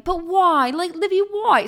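high-pitched mock whine; London pleading. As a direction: imitate a man begging petulantly. Acting out his pleading in quarrel.

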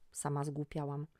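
ordinary delivery

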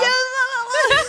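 high, drawn-out wail imitating sobbing; mock sobbing wail. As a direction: wail in a hammed-up bawl, half laughing. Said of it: "I just start sobbing more".

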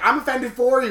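mock indignation; indignant. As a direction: protest on his behalf. Indignant reply in retold story.

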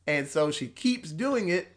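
ordinary talk

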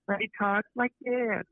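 high, drawn-out mocking lilt; Jordan Peterson lilt. Critic mocking how a speaker talks, exaggerated high lilt. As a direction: mimic an annoying singsong lilt, slow and high. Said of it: "he has this lilt when he talks".

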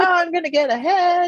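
high, put-on optimistic voice; mock eagerness. Mocking own homework plan to co-host, high mock-eager voice. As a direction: chirp it like a naive plan.

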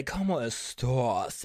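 slow, low drawn-out catchphrase impression; Wendy Williams. Shown as a demonstration. Host showing viewers the catchphrase, slow low impression. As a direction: draw each word out long and low. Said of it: "say it like Wendy Williams".

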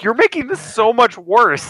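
mock exasperation; exasperated quote. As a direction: whine it out, fed up. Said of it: "I'm just like".

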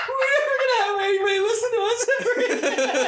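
high, breathless tears of laughter; crying with laughter. Real, not acted. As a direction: laugh until you cry. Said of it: "Yeah, I am crying".

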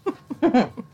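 short breathy chuckle. Real, not acted. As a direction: laugh it off lightly. Laughing after story.

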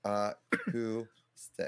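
very slowly, "acoustic" as uh... KOO... stick; practising "acoustic". Shown as a demonstration. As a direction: sound out "acoustic" syllable by syllable. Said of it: "Like coup".

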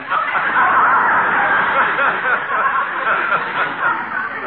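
sheepish laugh breaking the story; husband laughing at his own blunder; embarrassed laugh. Acted.

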